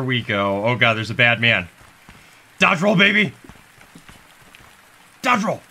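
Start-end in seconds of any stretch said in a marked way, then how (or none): 2.6-5.6 excited shout. Real, not acted. Hyped dodge in gameplay.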